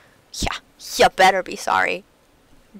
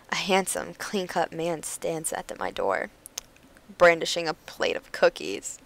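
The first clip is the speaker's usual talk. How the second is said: hushed narration; 4 semitones lower, 6 dB quieter than usual. A narrator's reading. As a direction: breathe it like a swooning secret. Narrating the handsome neighbor, hushed.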